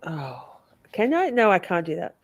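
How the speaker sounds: ordinary talk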